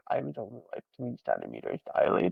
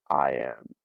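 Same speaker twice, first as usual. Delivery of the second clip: apologetic; 14 semitones lower, slower than usual. Retold or imitated. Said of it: "And she's like".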